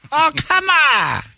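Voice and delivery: high, drawn-out comic outcry; mock protest. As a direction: wail it in exaggerated disbelief. Mock protest at Japanese not funny.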